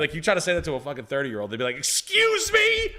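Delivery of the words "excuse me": much higher, slow, outraged Karen voice; indignant Karen. Retold or imitated. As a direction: play an offended Karen asking for the manager. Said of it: "everybody would be Karening out".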